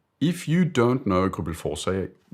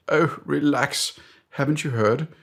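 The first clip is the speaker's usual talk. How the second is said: British boss; 9 semitones higher, 4 dB louder than usual. Acted. British boss soothing doubtful colleague, airy high mock-British. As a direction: brush off the worry in a British accent. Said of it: "I d-, don't know why he's British".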